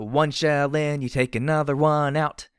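loud, high, stretched-out shout. A narrator's reading. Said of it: "I shouted as I blasted".

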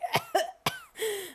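high choked cough; mock singalong cough; pretend coughing fit. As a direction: cough as if the high note defeated you. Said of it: "I'd be coughing and just like".

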